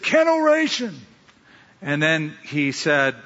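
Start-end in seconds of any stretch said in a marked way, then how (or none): 0.0-1.0 very high, ringing crowd chant; enthusiastic. Acted. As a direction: answer like a pumped-up sales crowd. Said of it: "with a lot more enthusiasm".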